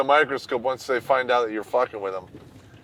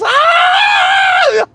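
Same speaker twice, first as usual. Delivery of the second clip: very high loud shriek; mock scream of terror, very high; shocked scream; 12 dB louder than usual. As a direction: shriek like a horrified neighbor. Neighbors shocked at funeral pyre.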